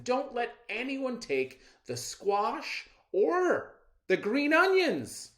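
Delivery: father warning his daughter before leaving; fussy father. Acted. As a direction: nag about the squash.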